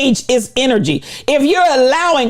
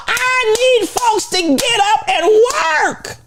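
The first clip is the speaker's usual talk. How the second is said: slow, high, pressed preaching shout; high, slow preacher's cry; preaching at full force; 11 semitones higher, slower than usual. Acted. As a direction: drive it home from the pulpit. Preaching climax.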